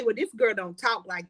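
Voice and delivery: lightly mock the critics who judge her. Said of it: "I know they say".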